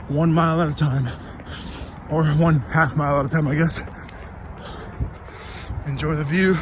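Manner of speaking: talking mid-run, loud and breathless; speaking while jogging; while running. Real, not acted. Recording mid-run, first leg.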